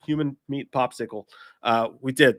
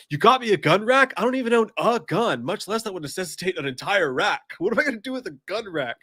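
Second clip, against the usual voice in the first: high, loud Garth quote; squeaky indignant gun-rack rant; quoting Garth; 7 semitones higher, 5 dB louder than usual. Retold or imitated. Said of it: "It's like".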